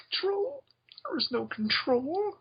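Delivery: high-pitched manic mad scientist; Doctor Insano. Acted. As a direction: shriek it like a madman. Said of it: "the Doctor Insano".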